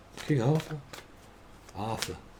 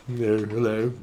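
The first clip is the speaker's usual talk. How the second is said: lightly voiced Prince Charles; 5 semitones lower, 5 dB quieter, slower than usual. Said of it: "This could be Charles".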